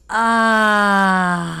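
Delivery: long, loud "ah" on the out-breath; audible exhale sigh. Shown as a demonstration. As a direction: let the breath out in one big open sigh.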